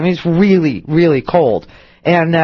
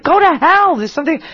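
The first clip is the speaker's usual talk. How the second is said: dog's look turned into words, pitched up; indignant dog talking back, higher; voicing the dog; 9 semitones higher than usual. Dog glaring at owner, a high mock huff. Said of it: "he looks at me like".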